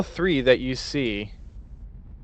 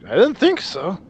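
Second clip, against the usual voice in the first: guard voice; 8 semitones higher, 4 dB louder than usual. Acted. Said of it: "everyone else looks at them and is like".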